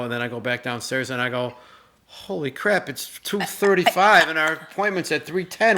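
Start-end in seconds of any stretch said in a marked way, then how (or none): 2.1-5.8 mock panic. Retold panic over late time.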